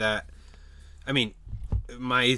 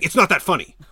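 loud, raised imitation of a scold; serious rebuke; 6 semitones higher, 9 dB louder, faster than usual. A guy objecting to women laughing boisterously, serious. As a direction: mimic an irritated man's telling-off. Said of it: "like, he sounded serious".

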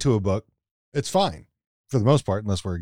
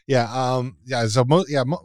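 ordinary talk

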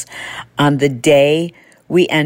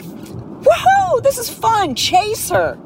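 dogs voiced much higher, gleeful whoop; excited dogs; slower than usual. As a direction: play the dogs thrilled at the chase. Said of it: "that's a cue for them".

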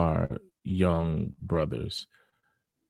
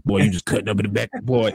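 imitating a raspy old smoker coach; quick gruff smoker's voice, fast; smoker's rasp; much faster than usual. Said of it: "he had that old smoker's voice to him".

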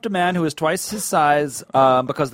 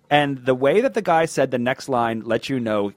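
normal talking voice

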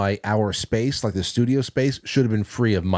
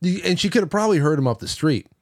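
ordinary delivery

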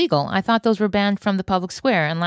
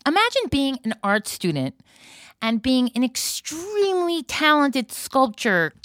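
ordinary delivery

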